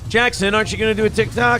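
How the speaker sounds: high, fast taunting call; leering catcall. Real, not acted. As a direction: jeer at him quickly. Heckling Jackson on video.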